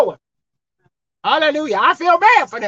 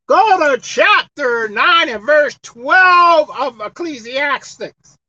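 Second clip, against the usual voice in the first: ordinary delivery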